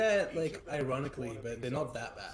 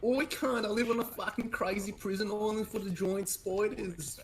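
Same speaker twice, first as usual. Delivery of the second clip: higher, slow, thick Aussie caricature; exaggerated Australian accent; 6 semitones higher, slower than usual. Streamer finally saying the chat's line, slow exaggerated Australian accent. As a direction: drag out the line like a broad Australian. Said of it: "I can actually say that line".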